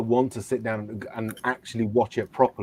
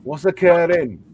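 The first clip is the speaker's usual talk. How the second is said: put-on slow, high Welsh accent; higher and louder Welsh catchphrase; 6 semitones higher, 6 dB louder than usual. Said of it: "the Welsh accent".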